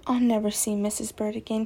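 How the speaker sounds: ordinary delivery, nothing marked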